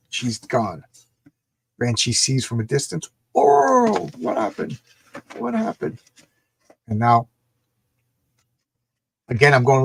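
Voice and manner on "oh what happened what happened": startled cry. Acted. Banshee reacts, seeing from afar.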